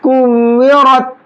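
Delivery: slow, melodic tajweed chant; slow Quranic recitation. Shown as a demonstration.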